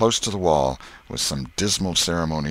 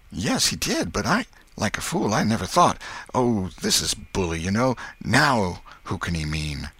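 Tom's relieved chatter; 4 semitones higher than usual. Acted. Tom admits he never thought, relieved boy voice.

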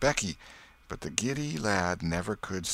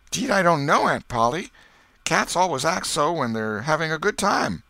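put-on high, loud country boy; boy's innocent answer; 9 semitones higher, 7 dB louder than usual. A narrator's reading. Boy explains cats to his aunt, high and innocent. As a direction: play innocent, a boy dodging blame.

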